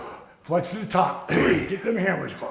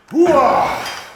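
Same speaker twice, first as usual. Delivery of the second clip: gung-ho shout; 9 semitones higher, 7 dB louder than usual. Real, not acted. Cheering finished set.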